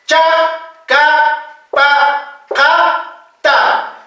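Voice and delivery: "Cha, ka, pa, ka, ta" with plain unaspirated stops; simple consonants. Shown as a demonstration. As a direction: drill each syllable for repetition, no puff. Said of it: "Consonantes simples". (consonantes simples, para repetir)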